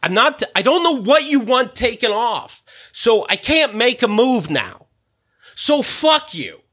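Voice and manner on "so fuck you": high, slow shout of "So fuck you"; furious yell at the friend; real angry shout. Real, not acted. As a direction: let it burst out, slow and furious.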